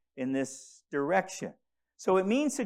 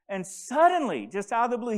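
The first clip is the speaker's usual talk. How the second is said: ordinary talk, nothing marked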